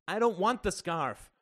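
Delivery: loud, high-pitched refusal; crying out. A narrator's reading. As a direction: protest at full voice. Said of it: "cried Justin".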